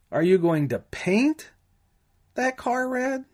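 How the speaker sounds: that jumps high after a rising paint; single out that car, not another; stress on that. Shown as a demonstration. Instructor demonstrating stress on that. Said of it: "or emphasize one word".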